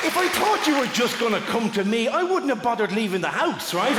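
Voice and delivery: high-pitched comic complaint; mock indignation. Comedian who toured to a man who came to him, mock indignant. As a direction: argue the absurdity, voice pushed up high. Continued mock outrage at audience member.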